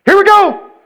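high, loud call to the crowd; big showman's count-in; hollered launch cue. Shown as a demonstration. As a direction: shout it to kick off a singalong.

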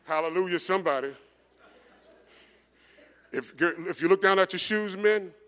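loud, lifted call to the church; preacher call-out. Real, not acted. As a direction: call it out to the whole room. Preacher calling out to congregation.

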